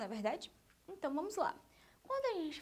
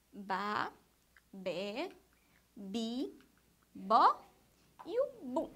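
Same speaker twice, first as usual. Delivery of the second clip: syllables recited one by one with pauses; drill-style repetition; 3 semitones lower, much slower than usual. Shown as a demonstration. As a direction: chant out each syllable separately for learners to repeat. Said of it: "vamos repetir mais uma vez". (sílabas ditas uma a uma, com pausas)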